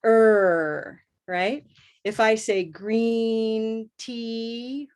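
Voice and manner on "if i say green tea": slow "green tea" with a long stretched E; long e sound. Shown as a demonstration. Teacher modelling the E vowel, slow and stretched. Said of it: "what's the sound".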